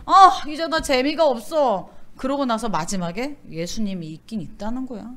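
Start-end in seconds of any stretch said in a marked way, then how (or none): 0.0-1.9 bored whine. Retold or imitated. Voicing a bored believer.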